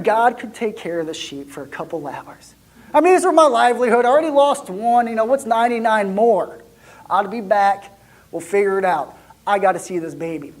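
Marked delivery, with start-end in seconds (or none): none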